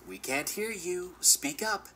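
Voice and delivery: high-pitched mock yell, no real volume; obnoxious heckle. A narrator's reading. Aaron heckling the narrator on stage, obnoxious mock yell. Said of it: "he would yell in a loud, obnoxious voice".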